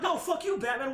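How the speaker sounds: high, loud laughing yell; mock shout. Heroes cursing out Batman, loud laughing mock yell. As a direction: scream it at Batman through laughter.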